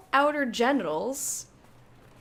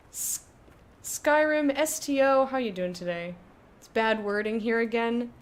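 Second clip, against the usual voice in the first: normal talking voice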